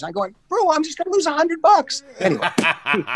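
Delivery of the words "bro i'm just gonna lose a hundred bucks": high-pitched, retold grumble of reluctance; mock protest. Golfer turning down his partner's bet, mock protest. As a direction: playfully balk in a raised voice.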